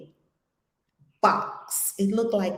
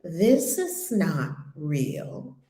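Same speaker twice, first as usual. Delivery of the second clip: very slow, deliberate quoted realization; calm realization; 3 semitones lower, much slower than usual. Retold or imitated. Retelling the woman's moment of realization, slow and deliberate. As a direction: draw out each word as it dawns. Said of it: "she came into realization, and she said".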